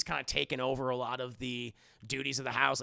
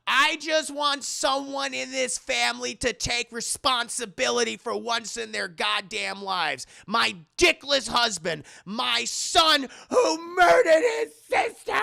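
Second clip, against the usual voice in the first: building from raised to a crying high shout; losing it; 7 semitones higher, 7 dB louder, slower than usual. Acted. Mother blaming son at dinner, building tearful shout. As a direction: erupt at the whole family, voice cracking. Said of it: "And she loses it".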